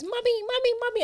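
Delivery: put-on high, insistent child's call. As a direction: call for mummy like a toddler. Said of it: "if Jenna goes".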